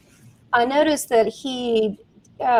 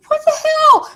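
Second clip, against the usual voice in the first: high cracking mock outcry, much higher and louder; outraged shout; 6 dB louder than usual. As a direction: shriek it like a betrayed twelve-year-old. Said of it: "I was like".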